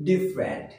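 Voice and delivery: "ডিফারেন্ট" said as "DIF-rent", two syllables; correct pronunciation. Shown as a demonstration. Teacher giving learners the proper form, "ডিফারেন্ট" as DIF-rent. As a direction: say "ডিফারেন্ট" dropping the middle vowel. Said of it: "কি হবে?".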